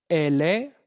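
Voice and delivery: ordinary talk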